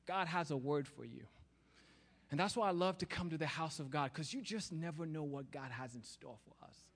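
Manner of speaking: ordinary talk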